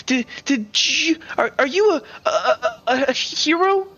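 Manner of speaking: put-on high-pitched, stammering kid voice; awestruck child. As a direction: play a starstruck kid tripping over the words.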